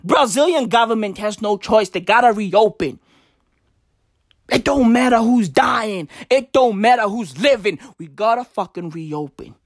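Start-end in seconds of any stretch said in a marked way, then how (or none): none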